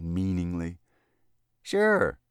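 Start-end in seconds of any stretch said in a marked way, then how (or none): 1.6-2.2 put-on high, loud cheery boy; cheerful. A narrator's reading. Elevator boy answers the lobbyist, cheerful. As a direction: chirp it up high, full of cheer. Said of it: "said Freckles cheerfully".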